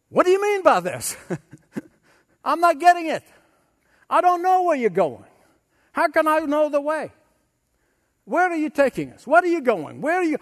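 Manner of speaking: high, anxious questioning voice; confused Thomas. Acted. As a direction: plead like a bewildered disciple. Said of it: "Honest Thomas".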